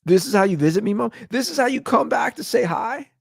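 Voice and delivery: high, loud, incredulous complaint; indignant disbelief. Retold or imitated. Son complaining to his late mom, indignant and high. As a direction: protest as if cheated. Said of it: "I was like".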